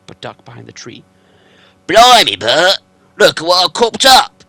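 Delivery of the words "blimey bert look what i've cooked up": a much louder, higher, coarse troll voice; gruff troll William. Acted. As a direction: bellow it like a dim-witted troll.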